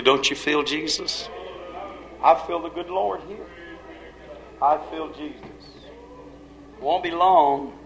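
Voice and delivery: quiet, reverent invitation, leaving space between phrases; hushed altar call. Real, not acted. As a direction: gently draw the congregation into prayer.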